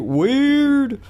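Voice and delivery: WEIRD stretched out and swooping up high; long, high-sliding weird; spooky teaser. Pretend. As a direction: drag out weird with a rising, eerie swoop.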